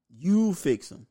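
loud high urging; emphatic. Real, not acted. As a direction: push it hard at the parents. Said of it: "urging parents".

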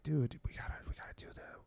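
urgent hushed whisper; conspiratorial whisper, barely audible. Retold or imitated. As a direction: whisper it in your buddy's ear. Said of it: "I was, like, whispering in his ear".

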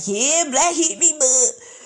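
hammed-up mock cheer; mocking the video people. Vlogger mocks people shouting it in fight videos, loud. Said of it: "and they like".

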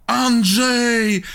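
high, drawn-out name call; mock calling out. Shown as a demonstration. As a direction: stretch the final syllable up high. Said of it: "ciężko jest jednosylabowo fajnie krzyczeć".

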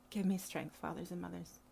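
soft, sighing plea; weary sigh. Retold or imitated. Mama pleading for the community, sighing and soft.

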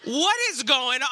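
dad at wit's end in the store; exasperated. Retold or imitated. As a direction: throw up your hands. Dad overwhelmed by tantrum.

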